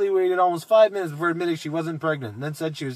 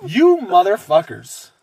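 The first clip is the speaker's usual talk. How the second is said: exasperated curse; 4 semitones higher, 8 dB louder, slower than usual. Real, not acted. Outraged at the parents.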